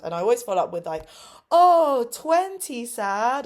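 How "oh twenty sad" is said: high, loud, drawn-out "oh" of concern; mock sympathy. Teacher always follows up to pupils, exaggerated sympathy. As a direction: coo it big and slow for little kids.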